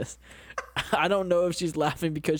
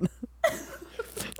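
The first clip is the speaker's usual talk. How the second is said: high squeaky laugh; 7 dB quieter than usual. Real, not acted. Awkward moment laugh.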